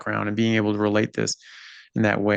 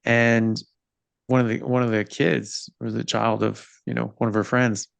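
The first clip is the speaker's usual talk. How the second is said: normal talking voice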